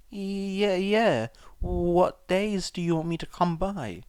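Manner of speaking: put-on a flustered boy agreeing too fast; a shaken boy taking the money; nervous Izuku. A narrator's reading. Voices Izuku.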